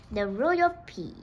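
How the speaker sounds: usual speaking voice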